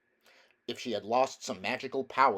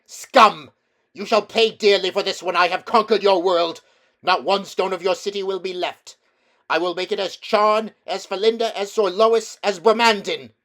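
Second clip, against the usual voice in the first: loud, high, triumphant threats; ringing witch voice; 9 semitones higher, 14 dB louder than usual. A narrator's reading. Witch threatening the crowd of London, ringing triumphant shout. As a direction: ring out like a great bell. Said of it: "voice of the witch, clear like a great bell".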